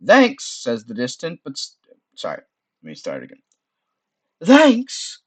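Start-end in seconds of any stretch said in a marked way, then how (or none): 0.1-0.5 high, loud call from far above; distant shout. Acted. Sorceress calling down to the adventurer, distant shout. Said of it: "a shout from far above". 4.4-5.2 high, loud call from far above; distant sultry shout. Acted. Sorceress calling down to the adventurer, distant sultry shout. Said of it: "distant, but still sultry voice".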